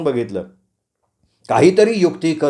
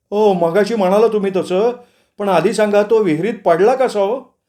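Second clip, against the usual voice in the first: higher put-on voice for a farmer introducing himself; farm owner Sadoba; 7 semitones higher than usual. A narrator's reading. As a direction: play an apologetic farm owner, pitched up. Narrator plays the farm owner. (मळ्याच्या मालकाचा चढा, वेगळा आवाज)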